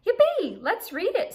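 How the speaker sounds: high, loud yippee; cheering narwhal. Acted. Narwhal cheers at the floating book, high and loud. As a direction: squeal it with glee.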